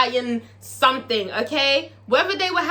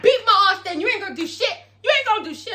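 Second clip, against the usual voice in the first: high, loud daring taunt; defiant taunt; 10 semitones higher, 3 dB louder, faster than usual. Retold or imitated. Gidget taunting Big Teak to his face. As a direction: dare him to try it. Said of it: "She's like".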